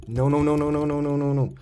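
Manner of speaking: real dread spilling out; panicked no's. Real, not acted. Panic after hitting friend's eye.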